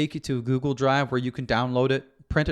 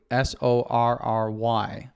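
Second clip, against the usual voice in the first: "S O R R Y" letter by letter, slow; spelled out slowly; slower than usual. Shown as a demonstration. Host to listeners, spelling the acronym slowly letter by letter. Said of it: "that acronym is sorry".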